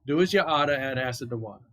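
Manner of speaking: Boston-accented rhyme, R's dropped; put-on Boston "oughta" and "wah-tah", falling away at the end. Shown as a demonstration. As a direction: chant the safety rhyme like a Bostonian. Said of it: "try to do my best Boston accent".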